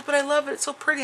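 high gushing voice; lady gushing. Retold or imitated. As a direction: gush over it sweetly. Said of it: "she goes".

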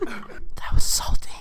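slow hushed whisper. Real, not acted. ASMR segment, whispering into mic.

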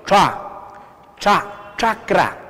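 'cha' twice with pauses, then chakra; spaced-out teacher's model of chakra, cha first; repeat-after-me demo. Shown as a demonstration. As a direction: lead a class through 'cha' and chakra, palatal onset. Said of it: "A ver, juntos conmigo".

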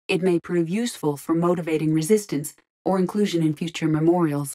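usual speaking voice

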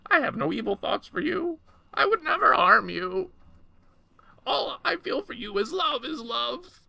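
very high, beseeching creature voice; creature crying out. A narrator's reading. Creature swears its love to madam, high impassioned plea. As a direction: profess it desperately, way up high. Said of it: "it cried".

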